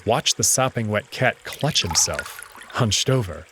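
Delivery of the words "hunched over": usual speaking voice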